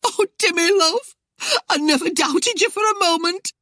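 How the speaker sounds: high, tearful wailing wife; blubbering. A narrator's reading. Wife crying to her husband Timmy, blubbering. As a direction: blubber it out, pitched right up. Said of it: "she blubbered".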